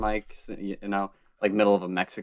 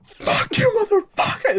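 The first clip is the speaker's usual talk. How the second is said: retold yell; 4 dB louder than usual. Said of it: "my friend was just like".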